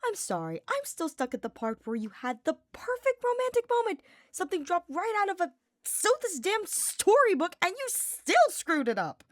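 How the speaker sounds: put-on high, loud teasing young man; Sylvain voice.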